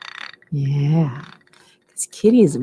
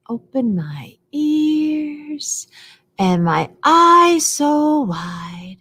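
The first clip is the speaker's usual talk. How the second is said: slow action rhyme; 9 semitones higher, much slower than usual. Shown as a demonstration. Action rhyme, slowed for kids.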